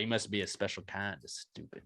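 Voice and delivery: quiet, low imitation of a gruff old cowboy; Sam Elliott impression. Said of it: "Sam Elliott".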